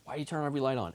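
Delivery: quick, lightly voiced protest from mom; a hint of an exasperated mom; imitating mom. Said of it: "my mom would be like".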